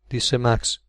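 ordinary delivery, nothing marked

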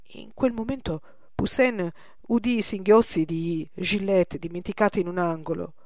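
usual speaking voice

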